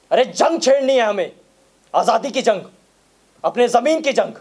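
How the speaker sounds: loud, high-pitched rallying call; fiery war cry. Acted. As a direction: rouse them to fight for their land. (जोशीली, ऊँची ललकार)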